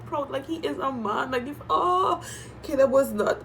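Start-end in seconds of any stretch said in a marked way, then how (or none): 1.3-3.4 voice breaking. Real, not acted. Overcome by Caleb's tears.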